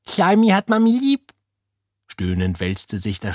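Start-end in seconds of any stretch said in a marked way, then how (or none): 0.1-1.3 high, loud squeaky creature voice; doting slime creature. A narrator's reading. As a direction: squeak it like an adoring little blob. Slime creature declares love to Oda. (hohe, anhängliche Schleimstimme)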